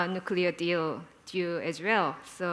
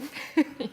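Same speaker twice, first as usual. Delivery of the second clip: short laugh; 7 semitones higher than usual. Real, not acted. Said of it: "Why are you laughing?".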